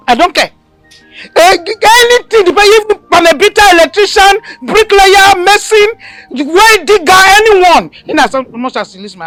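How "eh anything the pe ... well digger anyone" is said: high, loud, slow list; fed-up mother listing any man; exasperated rant. Acted. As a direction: reel off anyone at all. Mother ranting, listing suitors.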